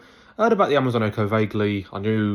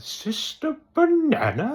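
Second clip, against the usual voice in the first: very high, slow, drawn-out squeal; exaggerated high-pitched questioning wail; awful character impression; much slower than usual. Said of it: "that was an awful impression".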